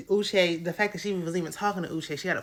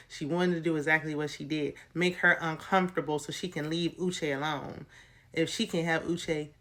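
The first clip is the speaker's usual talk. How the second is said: ordinary talk, nothing marked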